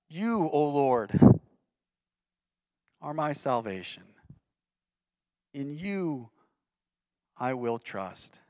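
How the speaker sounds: slow and hushed. Acted. Pastor voicing David's faith back to God, hushed.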